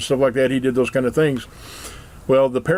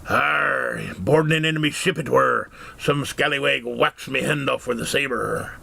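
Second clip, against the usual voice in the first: a hint of an old sea dog; pirate voice; 3 dB quieter, slower than usual. Pirate telling the dad about his hook, lightly growled. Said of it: "he says".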